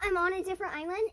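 ordinary talk, nothing marked

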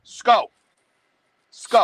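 "Sco" as one short falling syllable; Brooklyn contraction. Shown as a demonstration. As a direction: run let's go together into "Sco". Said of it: "it's a hard one".

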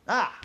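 short high loud startled cry; sudden high frightened shout; startled yelp. Acted. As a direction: jump out of your skin with a yelp. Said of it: "nearly jumped out of his skin".

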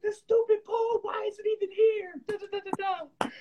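very high, teary mock wail at the pole; whiny tantrum. Her old self blaming the bed pole, a high mock wail. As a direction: wail like a toddler cheated by furniture. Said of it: "Flip the bed over, like".

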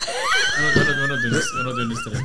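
very high squealing falsetto, hammed up; mock squeal. Joker squealing at friends to stop the argument. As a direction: squeak it out to shut them all down.

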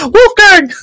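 a squeaky, very high cartoon call of the name; Mickey Mouse voice. Wolfgang's name in a Mickey Mouse squeak. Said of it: "Wolfgang's voice was like Mickey Mouse".

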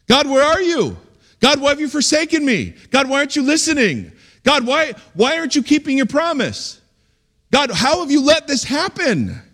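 high-pitched, indignant pleading with God; angry prayer. Acted. Preacher models psalm prayers to God, high angry pleading. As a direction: demand answers from God, pitch pushed right up. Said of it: "learn how to pray angry".

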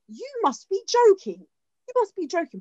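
as if the idea is absurd; incredulous. Real, not acted. Lecturer scoffing at the British demand, high and incredulous.

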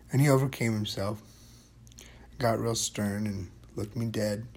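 ordinary delivery, nothing marked

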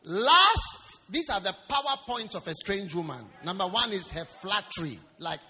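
loud, emphatic sermon delivery; preacher projecting to the congregation; forceful preaching. Real, not acted. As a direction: drive the numbered points home loudly.

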